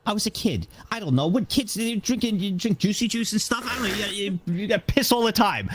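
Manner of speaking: high-pitched, exasperated self-defence; worked-up, high-pitched excuse; defensive protest. Real, not acted. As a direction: protest indignantly in a squeaky high voice. Defensive reply to bed-wetting question.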